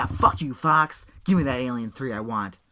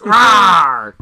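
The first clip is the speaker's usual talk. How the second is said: playful loud dinosaur roar with a laugh; 4 semitones higher, 15 dB louder than usual. Pretend. Dinosaur tangent, roaring playfully.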